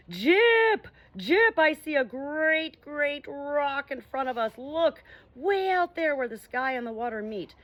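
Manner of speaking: high, loud excited cry from the masthead; calling out loud. Acted. Owl on the mast shouting down to the ship, high and loud. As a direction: shout it out, spotting land. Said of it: "crying out at the top of his voice".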